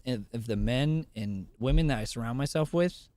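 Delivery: ordinary talk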